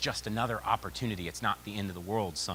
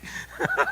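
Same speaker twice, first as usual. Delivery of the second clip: short real laugh; 6 semitones higher, 5 dB louder than usual. Real, not acted. Speaker laughs at his wife's joke to audience. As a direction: laugh at the punchline.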